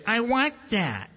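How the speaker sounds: high, slow whiny demand; whiny toddler. Retold or imitated. Grown son mimics a toddler demanding from his parents. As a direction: whine it like a toddler. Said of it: "Or I didn't say".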